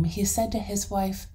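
ordinary delivery

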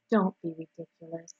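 soft, composed rebuke; calm dismissal. A narrator's reading. Charlotte answers Wilbur's outburst, quietly dismissive. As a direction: brush it off quietly.

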